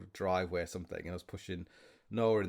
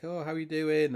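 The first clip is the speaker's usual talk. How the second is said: mock customer chit chat; 6 semitones higher, 3 dB louder than usual. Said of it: "chit chat".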